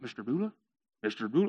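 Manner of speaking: low, soft questioning call; tentative calling. Acted. Camel waking his sleeping owner, tentative. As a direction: try softly to rouse a sleeper.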